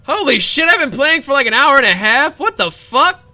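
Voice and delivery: high, loud disbelief at the clock; shocked outburst. Real, not acted. Streamer realizing how long he played, shocked outburst. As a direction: yelp it out in real shock.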